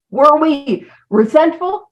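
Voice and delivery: ordinary talk, nothing marked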